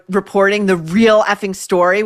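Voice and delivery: ordinary talk, nothing marked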